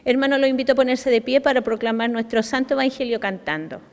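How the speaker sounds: ordinary talk, nothing marked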